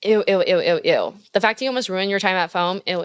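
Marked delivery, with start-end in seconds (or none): none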